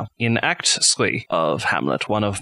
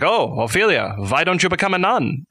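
put-on higher, lilting German-accented prince; Hamlet feigning madness; 7 semitones higher than usual. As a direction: swoop up in pitch, playing crazy. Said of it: "he is like".

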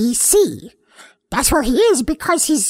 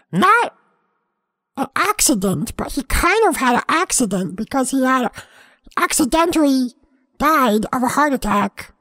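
normal talking voice

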